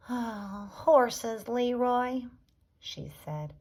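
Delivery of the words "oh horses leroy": loud, high, exasperated answer; sigh, then hit the answer loudly. A narrator's reading. Beatrice telling Leroy what he lacks, exasperated. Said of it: "Beatrice sighed again".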